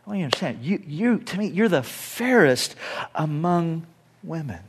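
quiet loving husband, earnest; tender reassurance. Retold or imitated. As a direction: soothe her doubts quietly. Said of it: "he's like".

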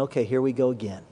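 low, quick, resigned mutter; weary resignation. Retold or imitated. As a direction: sigh it off as the same old trouble. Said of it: "he's probably thinking".

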